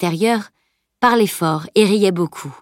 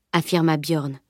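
usual speaking voice

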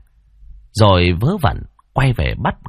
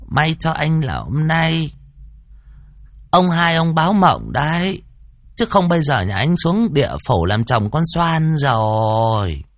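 put-on high, slow elderly matriarch; grave old lady giving a drawn-out warning; old woman's voice; 6 semitones higher than usual. A narrator's reading. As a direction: draw the words out like an aged granny. Old great-grandmother reveals a warning dream.

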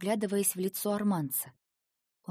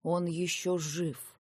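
медленно и спокойно, без волнения; говорить бесстрастно, как отшельник; невозмутимый старик. A narrator's reading. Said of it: "невозмутимо сказал старик". (невозмутимый голос старика)

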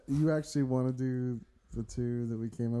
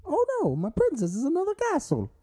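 very high cartoon plumber voice; Mario; 3 dB louder than usual. Co-host auditioning with a given line, high plumber impression. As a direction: squeak it in falsetto as the Italian plumber. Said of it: "I think you got Mario".

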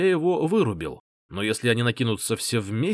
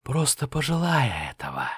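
eerie whisper. Acted. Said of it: "жуткий шёпот в ухе".

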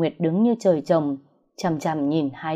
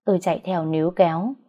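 ordinary delivery, nothing marked

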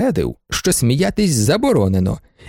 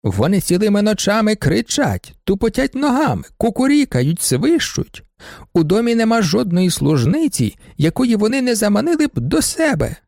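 put-on high, complaining old lady; indignant baroness; 7 semitones higher than usual. Baroness complains to the judge about students, high and aggrieved. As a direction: whine out her grievances, high-pitched.

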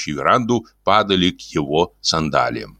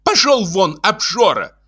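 high female voice, scolding; irritated woman's retort from another room; angry wife; 12 semitones higher than usual. Acted. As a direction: send him packing in a high voice. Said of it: "ответили из комнаты".

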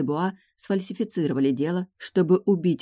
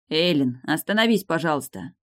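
ordinary delivery

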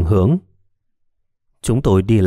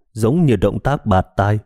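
ordinary delivery, nothing marked

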